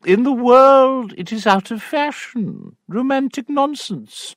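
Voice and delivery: high, stern aunt voice; a pressing aunt, pitched high; prim aunt. Acted. Aunt pressing Sophia to marry, high stern voice.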